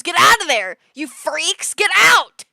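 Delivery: loud, high shouted get out; hammed-up shout at creepy guys; mock yell. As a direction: chase them off with a yell. Shooing off nineteen-year-olds.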